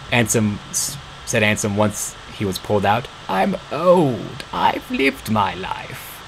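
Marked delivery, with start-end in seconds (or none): 3.3-6.2 put-on soft, slow old man; resigned farewell; old father voice. A narrator's reading. Old father says goodbye.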